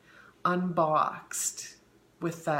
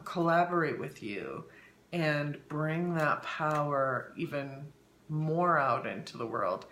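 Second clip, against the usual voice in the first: ordinary delivery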